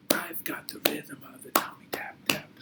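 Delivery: fast unvoiced whispered chant; quick whisper. Teacher repeating the rhyme for kids, whispering fast.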